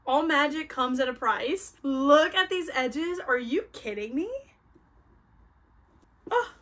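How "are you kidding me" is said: ordinary delivery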